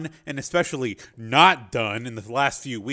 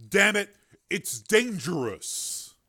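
higher, slow, pleading call; calling after her; 6 semitones higher, slower than usual. Acted. Miller calls after Mara as she swims off, urgent and slow. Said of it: "Miller called after her".

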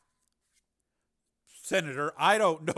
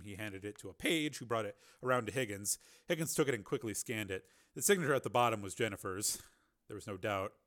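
plain reading voice

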